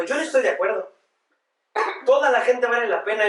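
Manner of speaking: mild indignant protest, rising at the end; indignant objection. Retold or imitated. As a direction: push back, offended. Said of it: "la que estaba ahí al lado dijo".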